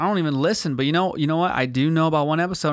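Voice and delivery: ordinary talk, nothing marked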